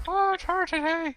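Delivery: mock voice break, very high; high comic squeak; broken-voice imitation. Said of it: "kind of unbroke itself temporarily".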